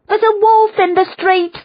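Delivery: very high, loud cry of alarm; alarmed shout. A narrator's reading. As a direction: scream it from the window. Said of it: "the woman shouted".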